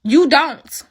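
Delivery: defiant closing repeat. Real, not acted. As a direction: punch it back harder. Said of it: "repeated retort".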